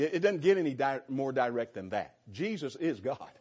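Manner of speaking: ordinary talk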